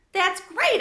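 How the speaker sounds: high, loud squeak of a hamster; squeaky hamster. A narrator's reading. Hamster squeaks his delight at Sophie's words, high and loud. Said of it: "I squeaked".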